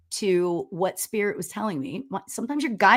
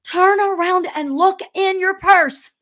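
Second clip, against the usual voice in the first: higher, louder, slow commanding call; spirit yelling; 10 semitones higher, 6 dB louder, slower than usual. Retold or imitated. Spirit guide yelling a warning at her. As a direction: boom it out like a voice in her head. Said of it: "like, yelling at me".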